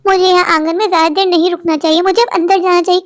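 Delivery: plain reading voice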